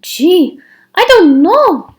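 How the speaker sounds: high, loud dithering voice; an airheaded girl unsure; dim girl. A narrator's reading. Myra dithers in a high, loud empty-headed voice. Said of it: "said Myra".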